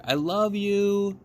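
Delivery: put-on high, slow girl's voice calling out; high slow girlish call; little girl calling. A narrator's reading. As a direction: call it across the water to grandma. Girl calls to grandmother.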